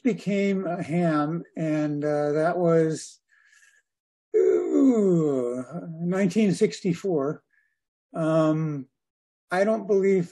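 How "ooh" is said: normal talking voice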